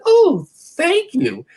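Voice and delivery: gushing grateful lady. Retold or imitated. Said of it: "and she said".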